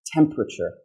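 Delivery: TEMPERATURE as TEM-pruh-chur with a puff on the T; aspirated T. Shown as a demonstration. Teacher repeats the correct word for learners, aspirated T. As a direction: release air on the first T of TEMPERATURE. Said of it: "the aspiration".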